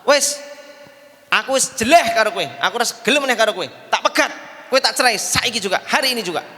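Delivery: angry husband. Retold or imitated. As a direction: snap at her in mild hammed-up anger. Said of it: "Dia marah".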